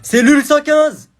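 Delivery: loud, slow guard call. Acted. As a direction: bark the cell number out slowly. Guard calls out cell number.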